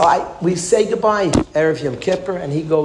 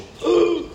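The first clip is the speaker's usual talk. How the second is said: gasp. Acted. Mock shock at late arrival.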